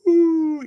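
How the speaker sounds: squeaky high ooh; a playful falsetto ooh; high mock ooh. Voicing the spider's leap.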